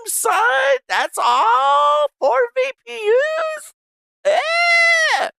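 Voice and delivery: high, loud, incredulous ranting shout, building to a yell; outraged disbelief. Real, not acted. Streamer ranting at Amazon server pricing, incredulous yell. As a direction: yell at the price like it insulted you.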